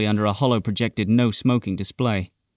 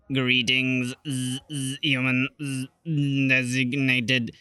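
slow, glitchy spluttering robot voice; old rusty robot; 2 semitones higher, much slower than usual. Acted. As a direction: sputter it out like a broken-down droid. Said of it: "the ancient robot spluttered out through its severely outdated voice processing unit".

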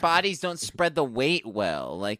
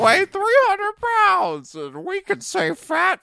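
Bean voice; 5 dB louder than usual. Retold or imitated. Continues Bean impression.

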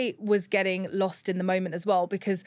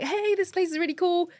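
high-pitched, breezy holiday cheer; carefree chatter; 9 semitones higher than usual. Retold or imitated. Woman retelling her happy moment in Greece, carefree and high. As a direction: imitate yourself happily chatting, pitched well up.

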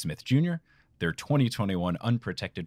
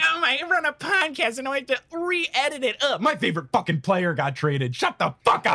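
mock whiny cohost; 14 semitones higher, 3 dB louder than usual. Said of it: "you were like".

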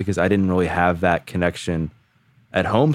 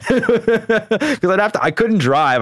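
high laughing aside; 14 semitones higher, much slower than usual. Real, not acted. Telling his story, laughing high and slow. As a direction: laugh through the words.

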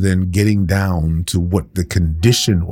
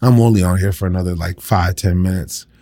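ordinary talk, nothing marked

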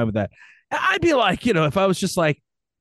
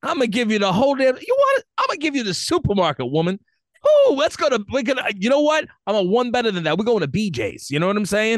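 higher, fast, hyped-up boasting to his woman; hammed-up lover; 7 semitones higher, faster than usual. Boyfriend hyping up his girlfriend, hammed-up and excited.

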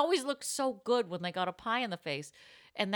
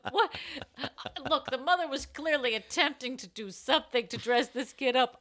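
ordinary delivery

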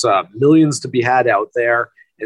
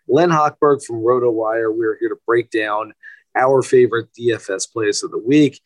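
ordinary delivery

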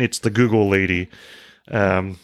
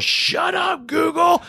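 much higher shouted command; yelling; much slower than usual. Retold or imitated. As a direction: shout at the speaker to stop. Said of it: "Charlie yells".

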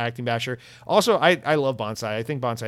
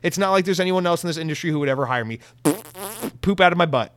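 mock Kobayashi quote; 5 semitones higher, 5 dB louder than usual. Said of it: "Kobayashi says".

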